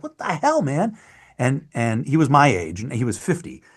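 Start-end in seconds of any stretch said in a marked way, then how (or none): 0.0-1.0 much higher, mock outraged; indignant protest. Young actor protesting to his older friend, high and indignant. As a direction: squeal it in disbelief. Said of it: "I'd be like".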